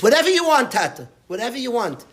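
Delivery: high, loud eager daughter; daughter's eager offer. Retold or imitated. Daughter offering her father anything for Shabbos, high and eager. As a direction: gush it like a devoted daughter. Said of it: "Shir said".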